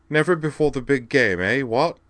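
put-on plummy upper-class English accent lifted high; Lord Peter Wimsey accent. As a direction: mimic a toff British gent, eerily exact. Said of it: "in an eerie impression of Devlin's Lord Peter Wimsey accent".

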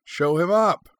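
very high, loud yell; short high-pitched shout; scream. A narrator's reading. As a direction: scream it like a heckler at a fight. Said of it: "Anakin Skywalker screamed".

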